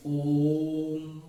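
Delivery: ओम held long and steady, like a chant; ओम sung out long as a pluta vowel; long drawn Om. Shown as a demonstration. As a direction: stretch ओम out as in prayer. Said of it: "उसे लंबा खींच के लेके जाते हैं".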